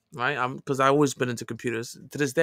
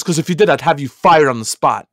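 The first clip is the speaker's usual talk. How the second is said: retold threat; 7 dB louder than usual. District manager threatening him.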